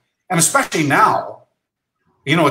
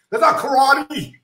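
scornful shout; 10 semitones higher than usual. Retold or imitated. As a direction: shout them down, much higher. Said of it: "The detractors".

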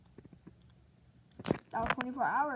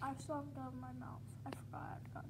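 whispering; 9 dB quieter, faster than usual. Real, not acted. Sleepy whisper.

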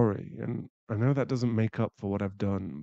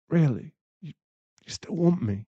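high, loud disbelief; stunned and hopeful; hopeful surprise; 5 semitones higher, 7 dB louder than usual. Acted. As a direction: ask in amazed relief. Surprised she forgives him.

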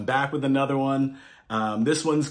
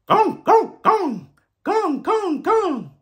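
very high, yelping barks; high dog barks; slower than usual. A narrator's reading. Reading to kids, yelping like a Bali dog. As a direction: yelp like a little dog. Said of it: "Dogs in Barley, Bali bark".

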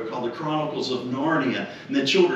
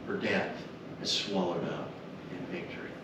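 usual speaking voice

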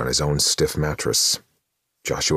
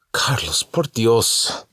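louder, much higher exasperated plea; 10 semitones higher, 5 dB louder, much slower than usual. Acted. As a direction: throw up your hands at your brother. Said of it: "sounding exasperated".

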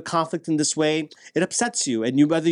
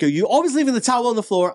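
a raised, accusing wife's complaint; mock-nagging wife; 7 semitones higher, 3 dB louder than usual. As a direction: nag about the towel, voice pitched up. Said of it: "going by my memory, what my wife says to me".

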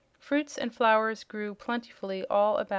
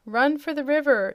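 loud, higher shouted command; 5 semitones higher, 6 dB louder than usual. A narrator's reading. Wizard ordering Jim to the river, loud shout. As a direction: yell at the horse to flee. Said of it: "shouted the Wizard".

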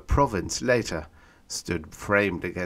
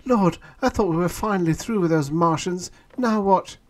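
high, louder fed-up woman; weary exasperation; 12 semitones higher, 6 dB louder than usual. A narrator's reading. Woman passenger complaining about Martians, exasperated. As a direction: sigh out your annoyance.

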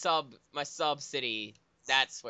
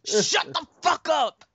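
panicked shouting; 11 semitones higher, 8 dB louder than usual. Acted. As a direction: scream in mock panic. Continued mock panic screaming.

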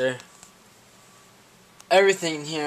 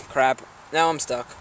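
ordinary talk

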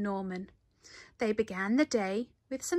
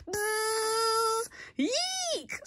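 very high squealing buzz then yelp; a bear squeals in fright; 13 semitones higher than usual. A narrator's reading. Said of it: "squealed the bear".

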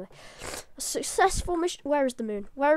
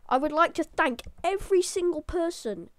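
overly hyper; 3 dB louder than usual. Real, not acted. Gamer announcing the moon landing to viewers, overly hyper. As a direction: proclaim the triumph, carried away with excitement. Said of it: "I'm getting too hyper about this".

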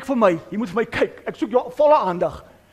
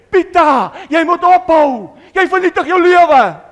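louder, higher urgent warning to a brother; urgent plea; 6 semitones higher, 7 dB louder than usual. Retold or imitated. Warning his brother Pieter about poison, urgent shout. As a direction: shout at him to stop before it's too late. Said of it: "Of gaan jy vir hom sê".

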